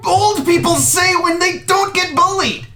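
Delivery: high, trembling panic; panicked kid. Acted. The beaten kid fears the bully will kill him. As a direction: babble in fear of getting hurt.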